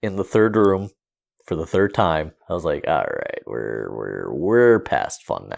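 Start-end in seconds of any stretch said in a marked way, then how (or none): 2.9-5.6 low, dragging, deflated delivery; imitating weary resignation, slowing down; resigned quote. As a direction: drop low and give up on the fun. Retelling his giving up on game.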